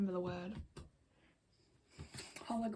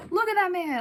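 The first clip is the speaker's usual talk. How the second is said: much higher, louder fan squeal; gushing; 8 semitones higher, 9 dB louder than usual. Real, not acted. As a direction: exclaim in delight. Gushing over member photo.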